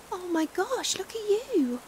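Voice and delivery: high pitched gasp of dismay; shocked and worried; shocked concern. Acted. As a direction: react to how drenched they are. Sees the listener soaked.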